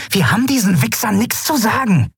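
put-on high, scornful young woman's voice; defiant insult. A narrator's reading. Amalin defying the captors, scornful high voice. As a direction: spit the insult out.

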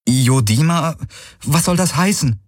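high, bewildered question; puzzled. A narrator's reading. Kirai asks Hawks what Yodima means, high and bewildered. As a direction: ask it back, pitched high.

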